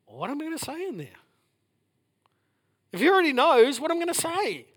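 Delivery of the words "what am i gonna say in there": puzzled inner voice. Retold or imitated. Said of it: "I go in to pray".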